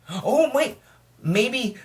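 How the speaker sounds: high excited "oh wait"; sudden realization. Real, not acted. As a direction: struck by an idea. Sudden idea.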